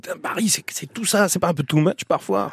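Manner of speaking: high, soft mock-astonished quote; voicing guest's amazement. Host imagining the dazzled guest's thought, mock astonishment. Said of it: "vous dites pas".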